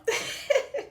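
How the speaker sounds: high nervous laugh through tears; teary laugh. Real, not acted. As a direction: laugh to hold back crying. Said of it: "about to start crying now".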